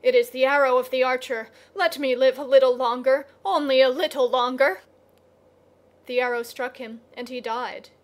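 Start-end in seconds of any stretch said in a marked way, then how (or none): 0.0-4.8 loud, hurried desperate plea; much louder and faster, pleading; crying out. A narrator's reading. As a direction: cry out in panic, begging for time.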